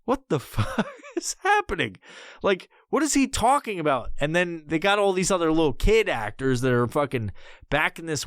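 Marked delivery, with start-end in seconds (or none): 0.0-4.1 baffled disbelief. Retold or imitated. Acting out his baffled reaction.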